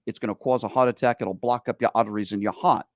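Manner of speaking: put-on mom's New York accent with dropped R's; thick Bronx, New York vowels. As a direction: imitate a Bronx mother's warning. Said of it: "with her Bronx, New York accent".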